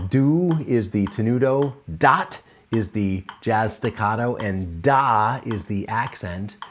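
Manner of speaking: "do" held long, "dot" short, "da" punched; name each syllable in its own articulation. Shown as a demonstration. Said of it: "I'm using those three articulations".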